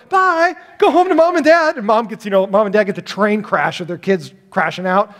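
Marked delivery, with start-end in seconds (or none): 0.1-1.8 much higher, louder sing-song goodbye; cheery send-off. Retold or imitated. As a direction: shoo the grandkids home cheerfully. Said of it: "And I go".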